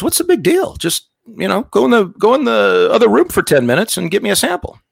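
high-pitched, impatient urging; exasperated doctor. Retold or imitated. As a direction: imitate a baffled doctor brushing off the fuss. Doctor impatient with missionary's excuses.

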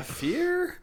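high, breaking into laughter on the word; laughing. Real, not acted. Laughs mid-sentence.

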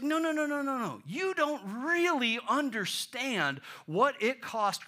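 emphatic high, loud objection; Paul. Acted. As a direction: cut in with urgent correction. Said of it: "This is Paul's emphatic no".

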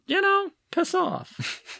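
high-pitched, drawn-out mock rebellion; playful defiance. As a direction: toss it off slow and high, as a cheeky rebel. Voicing a little rebel's defiance.

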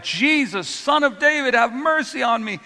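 a desperate plea called out over the noise; crying out. Retold or imitated. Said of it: "he continues to cry out".

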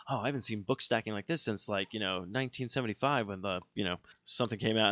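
put-on quiet nerdy aside about old book stacks; quiet muttered quote. Quoting Egon at book stacks.

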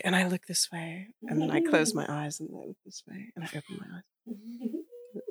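hushed breathy character voice; a dreamy, slowed-down character; Waiting for Guffman character. Retold or imitated. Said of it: "the Waiting for Guffman character".

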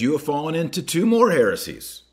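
high, loud indignant charge; loud, scandalised accusation; indignant declaration. Acted. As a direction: proclaim the heresies with outrage. Reading out the priest's charges.

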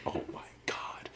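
hushed, breathy oh my God; soft stunned whisper; awed whisper. Retold or imitated. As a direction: whisper it in awe. Awed at knife design.

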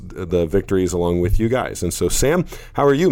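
normal talking voice

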